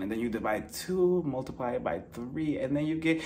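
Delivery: very soft, low and slow; mock calculating mumble. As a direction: count to yourself, drawing out each sum. Pretends to tally the strikes.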